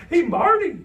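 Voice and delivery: loud, high cartoon squeak. A narrator's reading. Imaginary friend pops his head round the door, squeaking. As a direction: pipe up squeakily to a pal. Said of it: "he squeaked".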